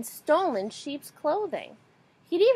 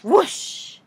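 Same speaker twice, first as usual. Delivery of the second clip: whoosh; 7 semitones higher, 8 dB louder than usual. A narrator's reading. Wolf blows house down.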